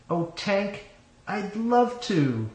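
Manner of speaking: high, drawn-out, lovestruck reply; delighted acceptance. A narrator's reading. Woman accepting a marriage proposal, high and delighted.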